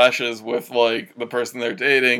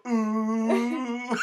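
drawn-out gossipy ooh; a juicy scandal ooh; ooh on request; 8 semitones higher than usual. Pretend. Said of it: "could you go".

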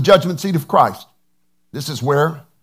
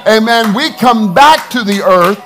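high, loud, rousing sermon; impassioned pulpit shout, punching the key words; full-force preaching; 6 semitones higher, 6 dB louder than usual. Real, not acted. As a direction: proclaim victory to the congregation at full voice.

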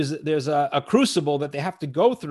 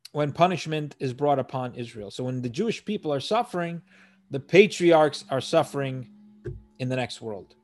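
ordinary delivery

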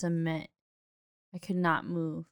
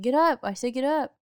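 higher, louder imitation of an insistent father; loud dad; 6 semitones higher, 6 dB louder than usual. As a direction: act out dad ordering his kid up. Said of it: "my dad's a loud guy".

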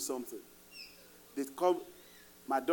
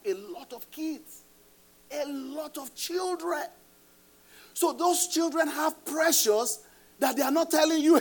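rising urgent preaching; 9 semitones higher, 3 dB louder than usual. Real, not acted. Rising alarm about children.

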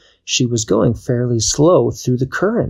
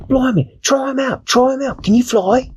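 much higher British pirate cheer; British cat. Acted. Kwazii urging Dashi to test her wings, high British voice. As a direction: egg her on like a cockney cat.

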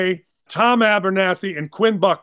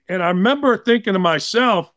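usual speaking voice

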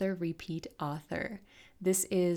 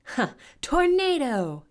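high, drawn-out battle cry; long, powerful attack yell; 10 semitones higher, 11 dB louder, slower than usual. A narrator's reading. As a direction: hurl the spell name out loudly. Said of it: "yelled Storm".

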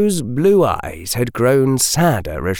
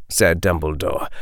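usual speaking voice

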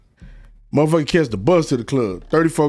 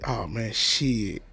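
deflated admission; 4 semitones lower, 8 dB quieter, much slower than usual. Retold or imitated. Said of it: "He like".

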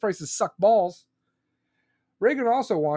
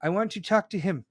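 soul's voice. Pretend. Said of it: "That was your soul saying".

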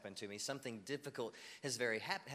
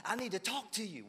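high-pitched, hammed-up excitement; so excited; 8 semitones higher than usual. Excited churchgoer runs up to the pastor, hammed-up excitement. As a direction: play someone bursting with mock-urgent news. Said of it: "run up to me so excited".

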